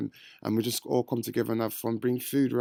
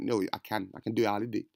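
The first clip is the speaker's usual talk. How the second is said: ordinary delivery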